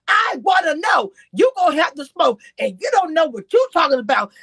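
mock the host going off at her; imitating the host. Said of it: "Here goes the host".

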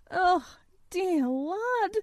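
high, slow, deeply moved cry of dear lad; tender outburst. A narrator's reading. Dickon's mother overcome at Colin's face, tender and moved. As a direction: let the feeling break through. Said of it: "she broke out tremendously".

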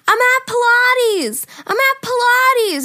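high, loud, drawn-out yell; shouting down the phone. Retold or imitated. Friend repeating himself to someone who can't hear, yelled. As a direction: stretch it out to be heard.

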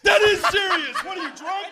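very loud, high-pitched outburst; indignant shout. Real, not acted. Co-host heckled over what counts as serious, indignant shout. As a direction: yell it in disbelief. Indignant comeback in live podcast banter.

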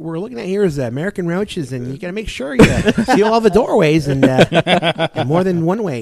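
professional service-call patter; demonstrating an on-the-job explainer voice; work voice. Shown as a demonstration. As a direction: switch into a customer-facing work voice. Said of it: "you got your work voice".

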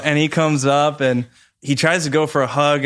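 normal talking voice

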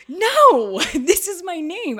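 high, shouted mock outrage; stubborn refusal. Actor insisting on keeping her name, stubborn refusal. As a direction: burst out loud and high, putting your foot down.

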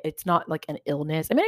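ordinary talk

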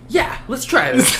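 high, slow eager voice; hammed-up eager agreement; eager mock voice. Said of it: "he's gonna be like".

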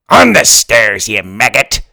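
pirate yell. Acted. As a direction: bellow it like a ship's captain. Pirate book yells at Ben.

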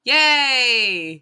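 loud sarcastic yay; sarcastic cheer. Acted. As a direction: cheer the bad news with mock delight. Cheering bad news of covid.

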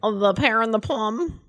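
ordinary delivery

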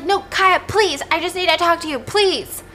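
high, urgent begging; pleading. Acted. Tate begging Kaya to talk, acted pleading. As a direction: beg to be heard, pitched high.

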